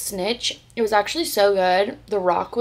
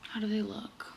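whisper; 13 dB quieter than usual. Real, not acted. Trying on new sunglasses.

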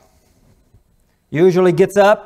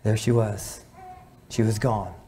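hushed, sombre; 2 semitones lower, 12 dB quieter than usual. Real, not acted. Preacher tells of father-in-law finding his wife dead, hushed. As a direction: let the voice sink for a sudden death.

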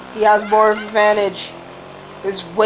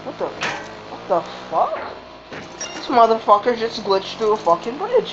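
ordinary talk

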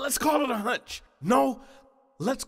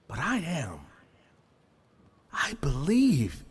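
whispering; 5 semitones lower than usual. Acted. Hushed confession of faith.

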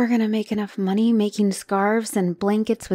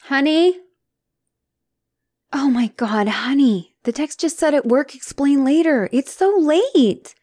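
higher, louder anxious questions; worried, agitated; 3 semitones higher, 7 dB louder than usual. Acted. Partner greeting him late at night, loud and anxious. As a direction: pour out worry at him.

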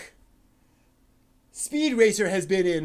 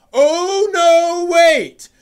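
much higher and louder, hammed-up alarm; mock horrified realisation; 8 semitones higher, 8 dB louder, much slower than usual. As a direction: cry out as if the worst just dawned. Mock panic over a live-action remake.

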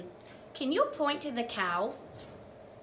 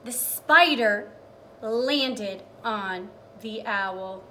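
slow dramatic reading; 6 dB louder, slower than usual. Acted.

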